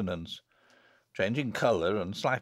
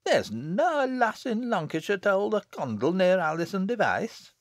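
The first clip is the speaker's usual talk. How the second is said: higher-pitched Lancashire lass praising her friend; loyal village girl; 8 semitones higher than usual. A narrator's reading. Damsel defending Alison against her sister, stoutly. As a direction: speak up warmly in broad dialect.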